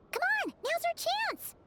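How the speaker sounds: high-pitched, excited urging; eager urging. Acted. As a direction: urge a friend on, pitched high. Urging companion once person has left.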